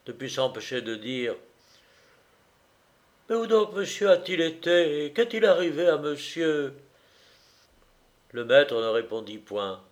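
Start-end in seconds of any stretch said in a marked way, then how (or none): 3.3-6.7 high-pitched, puzzled questions; astonished valet. A narrator's reading. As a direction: ask the master in a high servant's voice. Valet asks astonished at master's state.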